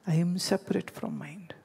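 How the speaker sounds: soft unvoiced whisper. Real, not acted. Teacher lets the key point sink in, hushed whisper. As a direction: breathe the truth as a secret.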